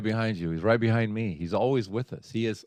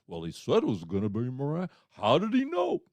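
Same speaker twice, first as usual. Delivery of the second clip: imagined coworkers puzzled. Retold or imitated. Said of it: "they would remember those words".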